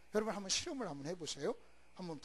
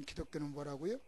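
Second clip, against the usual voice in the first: ordinary talk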